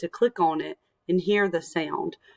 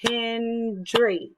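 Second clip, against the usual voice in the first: HEN-DRY, higher, in two long beats; syllable by syllable; 4 semitones higher than usual. Shown as a demonstration. Teacher showing how to clap her surname, "Hendry" in two beats. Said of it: "this is how I would do that".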